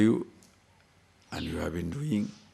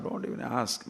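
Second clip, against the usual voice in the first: whispered aside; 10 dB quieter than usual. Acted. Aside dropped to a hush.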